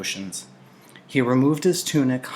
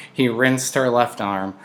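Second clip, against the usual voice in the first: ordinary delivery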